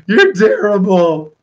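high, slow, wailing mock horror of the kids; shocked kids. Kids react to a shaved-off beard with wailing mock horror. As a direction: wail it like a stunned child, half crying, half laughing.

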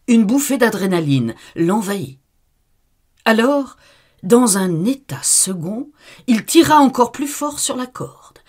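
narration montante, aiguë, forte; narration exaltée. A narrator's reading. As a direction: pousse vers le paroxysme. Climax du récit, voix qui monte. (narration exaltée)

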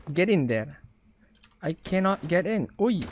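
ordinary delivery

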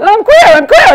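loud, high insistent cry; hammed-up shout of I'm queer; mock shouting. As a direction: yell it like a proud confession. Shouting coming out to Canadians.

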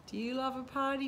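plain reading voice

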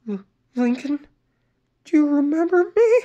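high, shaky-voiced tearful plea; tearful stammer. A narrator's reading. Sister stammering to her injured brother, tearful. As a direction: stammer it through tears. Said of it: "Luann stammered".

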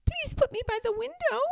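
very high, fast tiny bird; tiny baby robin. A narrator's reading. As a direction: chirp a quick request.

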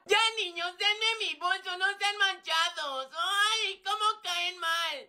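put-on high-pitched whining girl; whiny complaint. Regina begging boys for her bag, whining. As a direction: complain in a high, pleading whine.

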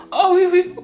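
high, squeaky, meek pushover voice; mocking a spineless guy. A spineless boyfriend agreeing with every insult, high and meek. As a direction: grovel in a silly high voice. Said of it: "faut que tu réagisses pas, que tu".

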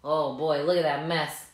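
ordinary delivery, nothing marked

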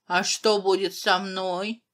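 usual speaking voice

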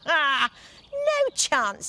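high, laughing refusal; laughing fairy. Acted. Fairy laughs at giant's trick, high and mocking. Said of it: "said the fairy".

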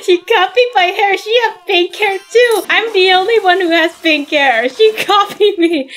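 high, whiny tattling; complaining that the other baby copied her, hammed up; whiny toddler complaint. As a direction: whine like a toddler upset at a copycat. Toddler complaining about a copycat baby.

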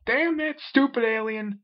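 high, loud, slow yell; a high angry yell. A narrator's reading. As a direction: yell it in fury at the alien. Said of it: "yelled the W B champion".

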